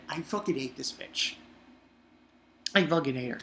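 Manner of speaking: normal talking voice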